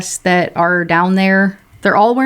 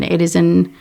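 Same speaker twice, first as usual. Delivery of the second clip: ordinary delivery, nothing marked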